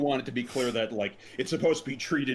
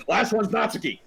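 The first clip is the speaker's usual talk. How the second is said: announcing loudly; 10 semitones higher, 6 dB louder than usual. Retold or imitated.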